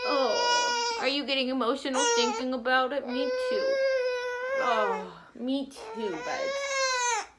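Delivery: real tears breaking through, high-pitched; getting emotional. Real, not acted. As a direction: choke up while comforting him. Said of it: "I get emotional sometimes too".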